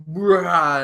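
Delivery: loud, high "rah" roar; explosive roar. Acted. Host acts out Ghost Rider's shocking burst of intensity, explosive roar. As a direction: burst out with a sudden mock roar. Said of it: "an explosion of intensity".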